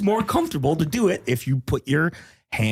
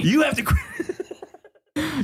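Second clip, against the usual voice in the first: laughing; much slower than usual. Acted.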